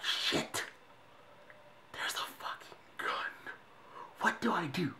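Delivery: breathless unvoiced whisper, slow; panicked whisper. Watching a cop draw a gun, whispering in fear. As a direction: whisper in fright, barely audible.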